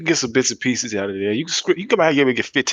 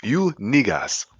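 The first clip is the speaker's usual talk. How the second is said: put-on African accent; 3 semitones higher than usual. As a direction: drag the words in an African accent. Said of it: "you should hear his African accent".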